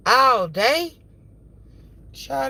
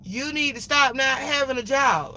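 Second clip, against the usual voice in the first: mock quarrel; 4 semitones higher, 3 dB louder than usual. Acted road quarrel.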